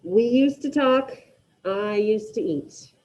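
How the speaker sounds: We used to talk and I used to eat read slowly, each word separate; teacher dictating phrases to the class; slow dictation. Shown as a demonstration. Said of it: "with these first two".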